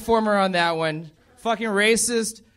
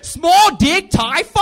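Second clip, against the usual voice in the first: higher, louder, slow, each word hammered; slow shouted repeat; 9 semitones higher, 9 dB louder, slower than usual. Retold or imitated. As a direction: repeat it slowly and loudly for effect. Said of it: "He's like".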